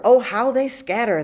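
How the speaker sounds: ordinary delivery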